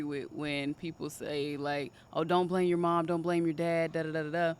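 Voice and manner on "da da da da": ordinary delivery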